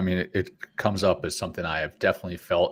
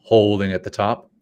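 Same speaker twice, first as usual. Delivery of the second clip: guided breathing. Acted. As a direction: coach the breaths gently. Leads breathing exercise.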